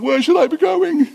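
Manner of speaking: high-pitched retold cry of confusion; hammed-up flustered self-quote; mock bewildered. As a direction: pitch it way up, acting lost in the crowd. Acts out being lost in crowd.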